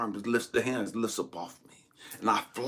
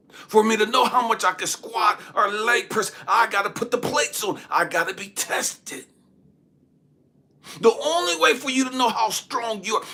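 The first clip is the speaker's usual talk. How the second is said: high, loud, rousing exhortation; full-force preaching; 13 semitones higher, 10 dB louder than usual. Real, not acted. Preacher urging listeners to go through trials, forceful. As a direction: drive each line up high and loud.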